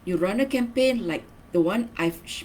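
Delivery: ordinary talk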